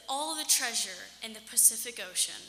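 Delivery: plain reading voice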